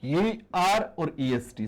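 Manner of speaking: दोनों suffix धीरे-धीरे बोलें; slow dictation. Shown as a demonstration. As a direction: spell out "ईआर और EST" slowly. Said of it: "ध्यान दें इस चीज को". (धीमे स्वर में पढ़ाते हुए)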